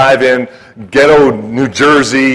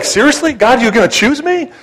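high-pitched incredulous question; incredulous, voice leaping up; acted disbelief; 9 semitones higher than usual. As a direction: ask it in mock astonishment. Acting out disbelief at being chosen.

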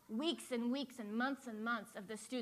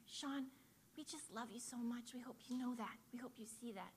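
soft whisper; 9 dB quieter than usual. Retold or imitated. Student comforting under table.

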